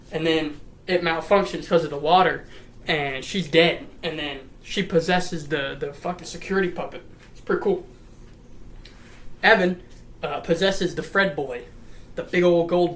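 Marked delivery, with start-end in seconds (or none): none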